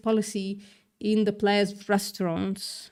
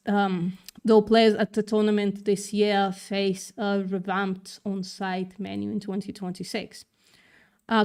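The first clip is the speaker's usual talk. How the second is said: ordinary talk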